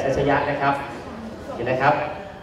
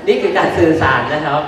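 real chuckle at the end of the remark; laughing; 3 dB quieter than usual. Real, not acted. Teacher joking with attendees about communication, laughing. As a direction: let the line tip into an easy laugh.

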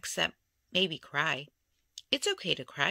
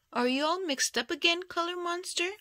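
put-on little girl; 10 semitones higher than usual. Narrator voicing the girl.

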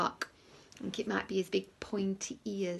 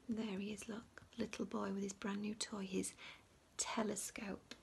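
put-on hushed whisper pointing out the boy; 13 dB quieter than usual. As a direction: draw them in with a whisper. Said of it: "showing picture to children".

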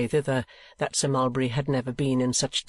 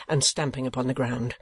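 ordinary delivery

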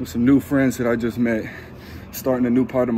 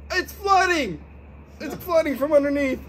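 surprised; 14 semitones higher than usual. Real, not acted. Sudden surprise in ice bath.

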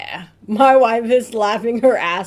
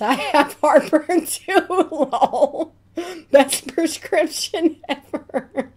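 laughing; 6 semitones higher, slower than usual. Acted. Laughing joke reply.

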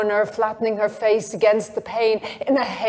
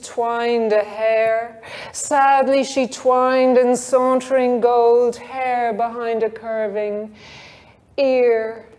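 ordinary delivery

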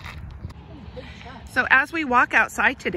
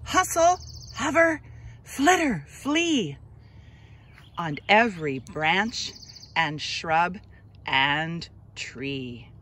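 put-on slow, expressive read-aloud for children; gentle storybook narration, drawn out; story narration; 3 semitones lower, much slower than usual. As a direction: read it like a picture book to little kids, lingering on each line. Reads picture book aloud.

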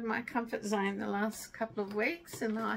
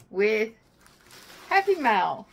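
excited; 8 dB louder than usual. Retold or imitated. Husband arrives with surprise parcel.

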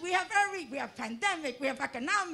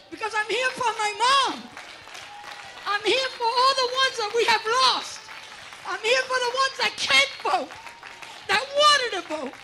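louder, higher rally cry; impassioned; 5 semitones higher, 9 dB louder than usual. Real, not acted. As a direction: lift it to the crowd for her mom. Rally speech grief turning to fervor.

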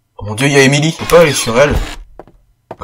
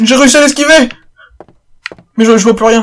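high, loud triumphant cry; excited shout; 10 semitones higher, 4 dB louder than usual. Real, not acted. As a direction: shout the win with glee. Dodged the monster.

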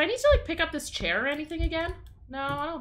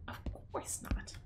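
slower breathy sarcastic whisper; 16 dB quieter, slower than usual. Real, not acted. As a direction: drag it out in a whisper. Sarcastic muttered aside.

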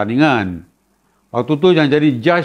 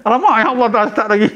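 mock dismay; 5 semitones higher than usual. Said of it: "Dia kata".

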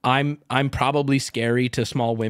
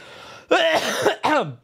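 laughing; 13 semitones higher than usual. Real, not acted. Laughing fit.

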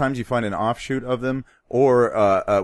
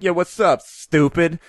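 higher, louder rapper greeting; 6 semitones higher, 4 dB louder, slower than usual. Retold or imitated. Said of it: "I'm like".

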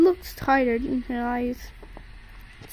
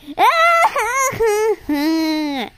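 fake baby cry; 6 semitones higher, 6 dB louder than usual. Baby starts crying.

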